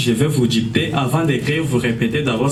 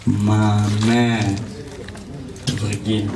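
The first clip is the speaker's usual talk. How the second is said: dictating; 4 semitones lower, slower than usual. Shown as a demonstration. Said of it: "Écrivez".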